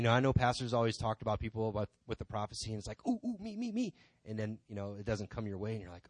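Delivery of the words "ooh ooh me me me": high-pitched "pick me" squeal; mock eager pleading. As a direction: beg to be picked like an overeager pupil. Mimics eagerness to receive prophecy.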